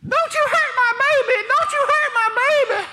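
high, loud mock holler of a ringside mama; hollering mother. Mama at ringside yelling at the opponent, shrill holler. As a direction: scream it like a mother protecting her child. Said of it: "His mama is there hollering".

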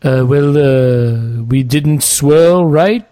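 slow, drawn-out post-match manager voice; hesitant manager answer with long, stretched words; bad Arsene Wenger impression. Co-host plays the manager answering a post-match question, bad Wenger impression. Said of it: "those are the two worst Arsene Wenger impressions".

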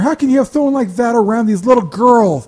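high, loud shrieking complaint; mock outraged mother. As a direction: squawk like a horrified mom calling to complain. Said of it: "calls of complaint".

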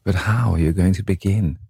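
usual speaking voice

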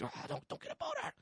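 squeaky high, strained and soft; a tight, high squeak of panic; panicked inner plea. Retold or imitated. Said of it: "it was just like".

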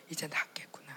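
soft whisper, stress on 이제; whispered relief. Retold or imitated. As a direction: breathe it out in quiet relief. Inner relief thought. (조용히 안도하며 속삭임)

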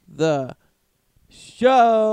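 word drawn out into a big, high, loud shout; show intro. Pretend. Host opening the show to callers, shouted announcer flourish. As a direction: blast it like a hammy announcer.

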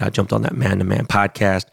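normal talking voice

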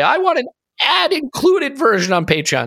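high, silly voice mocking a listener; mock fan request. Host mocks Patreon listeners who wanted the ads, high silly voice.

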